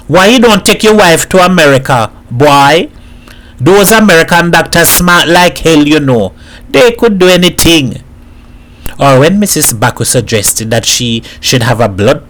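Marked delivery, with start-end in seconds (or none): 0.1-8.0 raised, higher-pitched mild shout. A narrator's reading. Man shouting advice to Edward across the street, mild shout. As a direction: call it out in Trinidadian dialect. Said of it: "Edward shouted".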